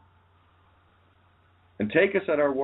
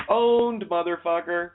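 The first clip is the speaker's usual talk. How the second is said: high, gloating shout; 7 semitones higher, slower than usual. Acted. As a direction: crow over the win. Gloating triumph.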